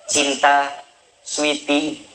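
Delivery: low, lightly voiced wife cooing pet names to her husband; a hint of a wife sweet-talking her husband; wife's pet names. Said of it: "mulai dari panggilan ke suami". (panggilan sayang istri ke suami, dengan suara rendah)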